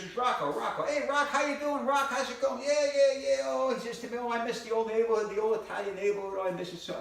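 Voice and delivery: lightly voiced nostalgic Italian pal; a hint of a homesick old paisan; old friend Rocco. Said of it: "He goes".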